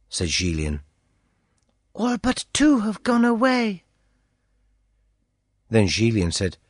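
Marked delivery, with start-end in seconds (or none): 1.9-3.8 put-on high girlish voice, hushed news; a young girl passing on a secret; girl's voice. A narrator's reading. Girl reporting to the prisoner at night, high girlish voice.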